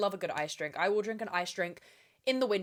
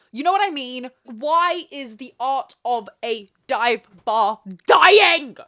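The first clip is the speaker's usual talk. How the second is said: loud outburst; 4 semitones higher, 12 dB louder, slower than usual. Real, not acted. Outburst in rant about dive bars.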